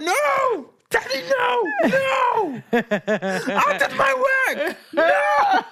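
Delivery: put-on very high shouting kid, laughing through; screaming child. Son screaming at dad to stop singing. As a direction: shriek like a desperate boy. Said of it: "he was like".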